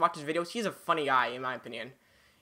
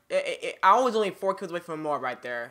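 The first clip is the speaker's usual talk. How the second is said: ordinary talk, nothing marked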